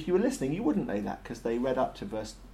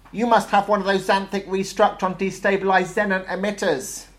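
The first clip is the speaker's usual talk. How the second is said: loud, high battle-machine voice; urgent guess; 8 semitones higher, 7 dB louder than usual. A narrator's reading. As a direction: rattle off the grand weapon name at high pitch. Tank guesses ever bigger weapons.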